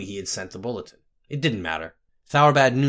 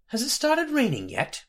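much higher, chirpy question; cheerful; 12 semitones higher than usual. A narrator's reading. Herbalist making small talk with a mute patient, cheerful. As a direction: ask it brightly in a high voice. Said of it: "she asked cheerfully".

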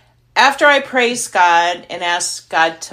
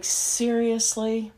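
slow, drawn-out disbelief; incredulous; 4 semitones higher, 6 dB quieter, much slower than usual. Retold or imitated. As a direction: stretch out Seriously in mock disbelief. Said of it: "I'm like".

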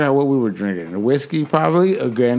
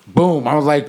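usual speaking voice

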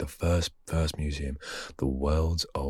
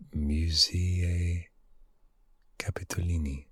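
Italian accent attempt; 3 semitones higher than usual. Shown as a demonstration. Trying the Italian name in a hushed, unsure Italian accent. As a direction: sound out the Italian name carefully. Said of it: "My Italian accent isn't that good".

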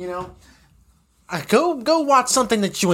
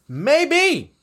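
MAYBE sung out high, then falling; high, emphatic "Maybe"; 7 semitones higher than usual. Real, not acted. Answers own question, first take.

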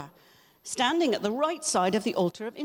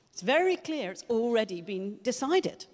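usual speaking voice

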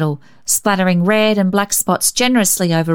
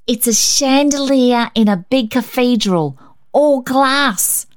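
put-on higher, proud little girl voice; 6 semitones higher than usual. A narrator's reading. Rhonda explaining her blot to Cecil, proud high girl voice. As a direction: show off her picture. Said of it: "she interpreted with pride".

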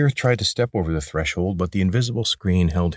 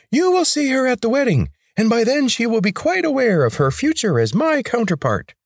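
much higher and louder, commanding; high grating rebel leader; 4 dB louder than usual. A narrator's reading. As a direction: bark orders in a high, grating voice.

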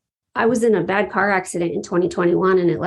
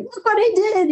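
very high, excited little boast; gleeful showing off; 13 semitones higher than usual. Retold or imitated. Normally introverted speaker showing off her video, gleefully high-pitched. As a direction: squeal it like a proud show-and-tell.

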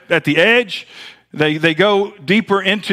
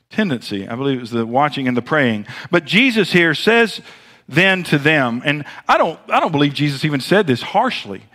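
usual speaking voice

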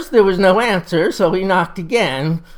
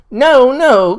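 louder, higher cry of protest; 8 semitones higher, 7 dB louder than usual. A narrator's reading. Patient to the woman approaching with his medicine, crying out. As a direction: cry out in alarm, refusing the dose. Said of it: "cried George McGill".

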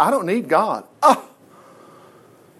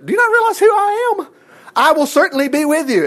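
much higher, commanding voice of God; God's voice; 9 semitones higher than usual. Retold or imitated. God telling a reluctant Moses he is with him, commanding. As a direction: boom out God's reassurance to Moses. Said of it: "And God was saying".

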